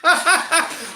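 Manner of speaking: loud, high burst of laughter; mocking laugh. Real, not acted. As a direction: laugh at his mistake. Laughs at co-host's math.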